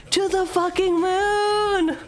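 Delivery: high, long-drawn rallying cry; drawn-out hype; hyped exclamation. Acted. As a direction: stretch it out high, like a rocket taking off.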